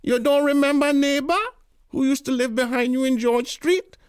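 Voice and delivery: put-on very high, loud old Caribbean auntie. A narrator's reading. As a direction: play the pushy old neighbour at full pitch. Tanti voiced high.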